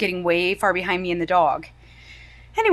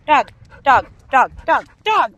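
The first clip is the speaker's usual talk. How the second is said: louder sharp repeated calls; calling out sharply; 3 semitones higher, 7 dB louder than usual. Real, not acted. Owner calling the dog to stop, sharp repeated calls. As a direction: snap the name over and over.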